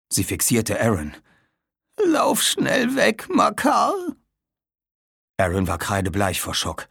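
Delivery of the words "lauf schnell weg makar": high, slow, breaking whisper then cry; dying old woman. Acted. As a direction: struggle for breath to warn them. (sterbende alte Frau, stockend)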